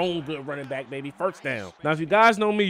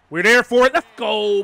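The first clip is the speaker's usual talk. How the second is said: loud, high hype shout; fired up, yell it at the screen; excited yell; 6 semitones higher, 9 dB louder than usual. Real, not acted. Defense sniffs out the screen.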